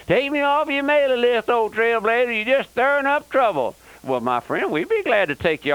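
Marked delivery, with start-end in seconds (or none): 0.1-3.8 higher, sneering voice of a complaining listener; mocking critic's letter. Preacher mocking a listener's complaint letter, sneering and pitched up. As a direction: mimic the grumbling critic, pitched up. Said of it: "We have folks write us".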